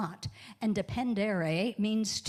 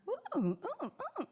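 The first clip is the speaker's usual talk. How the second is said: playful high ooh; 14 semitones higher, much faster than usual. Real, not acted. Playful reaction to congregation.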